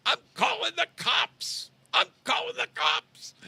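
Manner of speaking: high-pitched hysterical mock shriek; coming unglued. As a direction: shout it very high and loud, imitating someone totally losing it. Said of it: "she would just absolutely come unglued".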